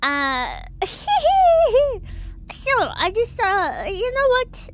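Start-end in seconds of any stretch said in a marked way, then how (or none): 0.6-2.5 sudden shrill giggle burst; high squealing laugh. Real, not acted. Streamer laughing at herself.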